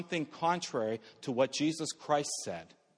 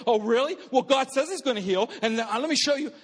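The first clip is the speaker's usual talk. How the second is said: argumentative comeback; 5 semitones higher than usual. Retold or imitated. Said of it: "The dumbest thing I can do".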